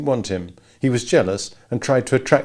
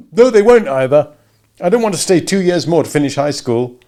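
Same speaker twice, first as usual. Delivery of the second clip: upset outburst; 7 semitones higher, 8 dB louder than usual. A narrator's reading.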